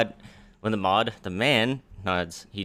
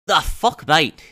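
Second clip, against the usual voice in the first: sudden high loud yelp of disbelief; shocked outburst; 7 semitones higher, 9 dB louder, much slower than usual. Acted. Merchant greeting a long-lost partner, stunned and loud. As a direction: blurt it out, stunned to see a ghost. Narbo shocked to see old friend.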